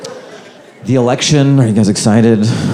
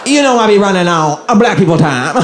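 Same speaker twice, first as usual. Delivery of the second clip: higher-pitched mock voice with put-on cackles; Kamala impression; 6 semitones higher than usual.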